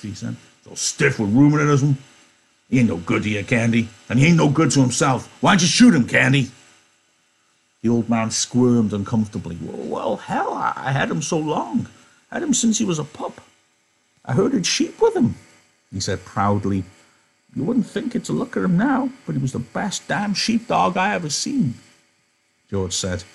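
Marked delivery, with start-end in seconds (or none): 0.6-6.5 loud and disgusted, higher pitched; loud disgusted Carlson. Acted. Carlson ordering Candy to remove the stinking dog, loud. 9.6-15.3 put-on very high, strained old man's voice; cling to the dog with every word; pleading old Candy. Old Candy begging to keep his dog, very high. 17.5-21.7 put-on very high, strained old man's voice; cling to the dog with every word; pleading old Candy. Old Candy begging to keep his dog, very high.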